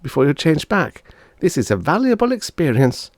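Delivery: put-on high kids' squabble; children bickering; child voices arguing. Siblings arguing about the chicken, high kid voices.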